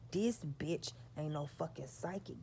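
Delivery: ordinary talk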